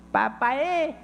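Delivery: a hint of a delighted toddler. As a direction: call Daddy the way his small daughter does. Father voices his toddler daughter.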